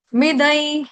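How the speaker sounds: ordinary talk, nothing marked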